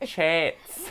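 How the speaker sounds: "Chats" said as "chets", Aussie style; Australian accent. Shown as a demonstration. Showing co-host the hard word, Australian "Chats". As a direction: give "Chats" an Australian eh vowel. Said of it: "a really difficult word to say in Australian".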